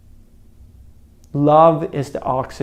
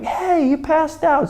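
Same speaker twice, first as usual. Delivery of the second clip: alarmed; 12 semitones higher than usual. Retold or imitated. Friend alarmed.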